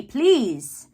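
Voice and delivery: ordinary talk, nothing marked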